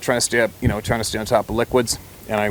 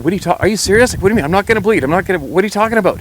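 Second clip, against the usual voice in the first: higher and louder, rushed frantic protest; panicked disbelief; 9 semitones higher, 5 dB louder, faster than usual. Retold or imitated. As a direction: blurt it out in alarmed disbelief. Panicked reply to doctor's warning.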